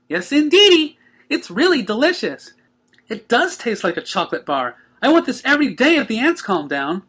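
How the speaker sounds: high, bubbly cartoon pony voice; chirpy excited pony; Pinkie voice. A narrator's reading. Pinkie telling the Cakes how she feels, high and bubbly.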